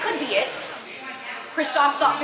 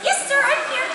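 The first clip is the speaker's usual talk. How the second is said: tiny bunny voice; 14 semitones higher, 4 dB louder than usual. Acted. Tiny bunny answers its king.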